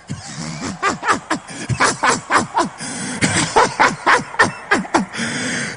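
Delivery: high, rapid staccato laughter; booming laugh. Acted. Preacher on seeing a devil, long high laugh. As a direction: burst into a long rattling laugh.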